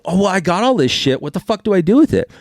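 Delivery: high and fast, a hammed-up flustered ask; mock overwhelmed. Asking what to do with it all, mock flustered. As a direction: throw up your hands in mock confusion. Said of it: "you go".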